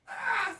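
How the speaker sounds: high, loud cry of pain; pained yell. Acted. Game master voices Auntie's axe wound, pained yell. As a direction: yell out as if struck in the side. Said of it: "yelling out".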